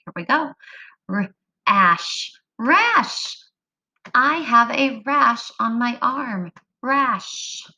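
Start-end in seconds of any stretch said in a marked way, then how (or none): 1.1-3.4 teacher tapping out a word; sounding out. Shown as a demonstration. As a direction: tap out the sounds, then blend. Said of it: "Get your fingers ready to tap".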